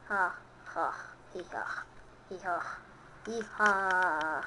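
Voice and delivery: low, rhythmic ha-hi laugh; deep silly victory chant; mock low chuckle. Real, not acted. Zafer sonrası komik ses. (alçak perdeden ritmik "ha hi" kahkahası)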